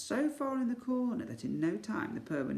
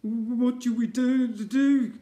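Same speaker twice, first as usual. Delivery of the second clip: scared; 8 semitones higher, 10 dB louder, slower than usual. Acted. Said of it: "the pair were scared".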